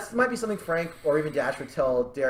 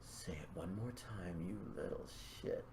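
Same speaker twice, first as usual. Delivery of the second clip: quiet menace; 10 semitones lower, 22 dB quieter than usual. Acted. She dares him to say it again, quiet menace. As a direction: sink into a soft, dark threat. Voices menacing reply in scene.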